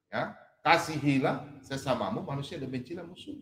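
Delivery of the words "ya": ordinary talk, nothing marked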